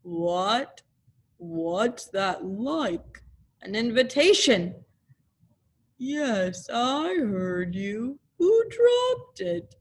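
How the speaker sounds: slow, glum donkey voice; gloomy Eeyore. Acted. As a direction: drag each word out sadly. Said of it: "Eeyore answers Owl".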